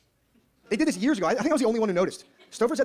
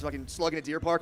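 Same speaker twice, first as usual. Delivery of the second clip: usual speaking voice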